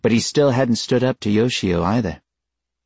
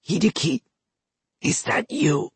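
croaking voice; 12 semitones higher than usual. Acted. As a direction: croak it out weakly. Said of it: "the voice croaked again".

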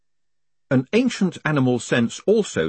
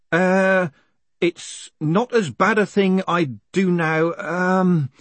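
slow, higher, drawn-out hesitation; stalling; 9 semitones higher, 4 dB louder, much slower than usual. Acted. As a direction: play for time with long ums. Condemned man stalls for time.

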